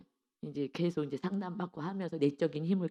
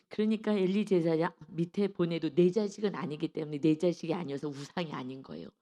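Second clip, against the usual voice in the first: ordinary delivery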